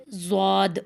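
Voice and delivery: زواد with lips rounded into a w; wrong rounded zwaad. Shown as a demonstration. Teacher demonstrates the faulty letter, lip-rounded زواد. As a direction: say زواد as "zwaad", gliding through w. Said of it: "ہونٹ گول ہو کر واو کی آواز بالکل غلط ہے".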